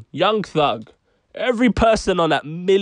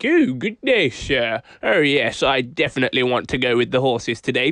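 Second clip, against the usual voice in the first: mock posh English; 4 semitones lower than usual. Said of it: "we're all like".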